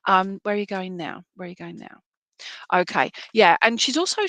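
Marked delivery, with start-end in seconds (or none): 1.3-2.0 softer, under the breath; quiet repeat. Real, not acted. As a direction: ask it again, almost to herself. Repeats question, much quieter.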